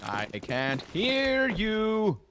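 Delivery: drawn-out, high captain's call; cartoon pirate captain. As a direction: goad the crew in a sing-song pirate voice. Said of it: "that was a great impression".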